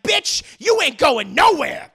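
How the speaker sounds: loud, high angry yell; threatening shout. Retold or imitated. Harley yelling at his girlfriend as she leaves, furious. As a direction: stop her leaving, furious. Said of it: "He's like".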